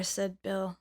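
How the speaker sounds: plain reading voice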